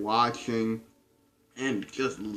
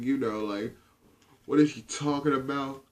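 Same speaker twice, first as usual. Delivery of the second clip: ordinary delivery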